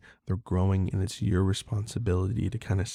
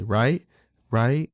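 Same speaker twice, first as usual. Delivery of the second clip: higher, louder, nagging rights; insistent kid; 5 semitones higher, 5 dB louder, much slower than usual. Retold or imitated. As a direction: nag for attention like a four-year-old. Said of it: "She'll say".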